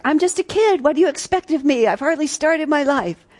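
put-on high, pleading kid voice; pleading young man. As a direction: defend yourself like a kid caught out. Boy defending himself to Jesus.

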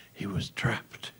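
soft breathless whisper; a narrator's suspenseful whisper; dramatic whisper. A narrator's reading. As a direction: hush it like a dreadful reveal. Narrator's dramatic locked-in moment.

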